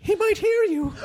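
put-on high, laughing girlfriend voice; Emily impression. Playing Emily hushing a friend, high and giggly. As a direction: squeak it out like a nervous gossip. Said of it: "love your Emily impression".

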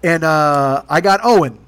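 ordinary talk, nothing marked

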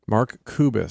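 Kubis said as KOO-bis; correct pronunciation. Shown as a demonstration. Co-host correcting the donor's name, Kubis pronounced KOO-bis. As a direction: say Kubis clearly with an OO, not "cubes". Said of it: "Say it correctly".

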